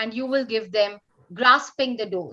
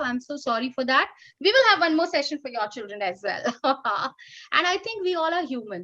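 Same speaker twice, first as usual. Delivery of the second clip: normal talking voice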